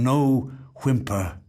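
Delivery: plain reading voice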